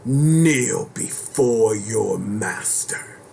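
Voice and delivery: slow, commanding villain line; booming demon lord. Pretend. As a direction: order them to kneel, slowly.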